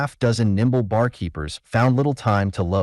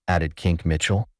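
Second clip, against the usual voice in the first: ordinary delivery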